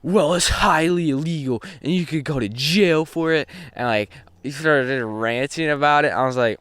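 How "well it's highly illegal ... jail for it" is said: theatrical, raised teacher voice; dramatic teacher. Retold or imitated. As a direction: lecture it like a melodramatic teacher. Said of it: "all dramatic".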